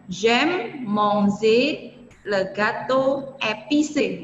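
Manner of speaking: slow, careful French reading; slow French model sentence. Shown as a demonstration. Teacher reads a French example to students, slow and careful. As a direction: spell out each French word slowly for the class.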